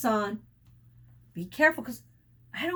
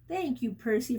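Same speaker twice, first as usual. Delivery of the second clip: plain reading voice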